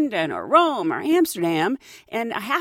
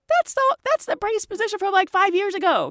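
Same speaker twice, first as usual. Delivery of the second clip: high teasing coworker voice; mocking coworker; 8 semitones higher than usual. Purser teasing the shaken flight attendant, high and mocking. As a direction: rib her with a high teasing voice. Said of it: "she looks at me and she goes".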